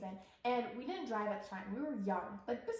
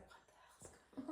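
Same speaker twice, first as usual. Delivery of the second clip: whispered confusion; 15 dB quieter, faster than usual. Retold or imitated. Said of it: "sober was like".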